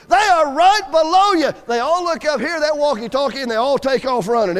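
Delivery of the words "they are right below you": loud, slow, higher yell of right below you; frustrated shout, each word drawn out; retold scream. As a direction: bellow it into the walkie-talkie. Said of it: "he screams in that walkie-talkie".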